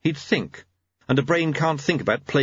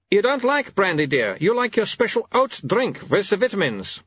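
high-pitched, bossy wife voice; firm wife; 9 semitones higher than usual. Acted. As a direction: correct your husband as if he were a child.

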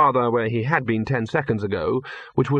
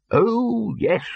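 cheerful; 10 semitones higher than usual. A narrator's reading. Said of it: "said the bursar cheerfully".

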